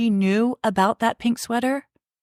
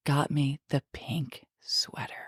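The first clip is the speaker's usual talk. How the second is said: hushed confession, slow; whispering; 5 semitones lower, 7 dB quieter than usual. Real, not acted. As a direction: whisper the secret slowly. Revealing a secret gift.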